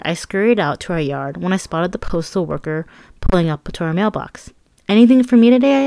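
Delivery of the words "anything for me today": high, loud eager question. A narrator's reading. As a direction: call it out excitedly. Eager teen runs to mailbox.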